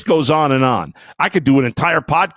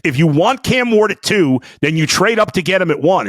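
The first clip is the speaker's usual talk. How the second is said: raised voice; 6 semitones higher than usual. Real, not acted. Host arguing the Browns must trade up, raised insistent voice. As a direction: hammer home the trade-up argument. Heated argument about draft trade.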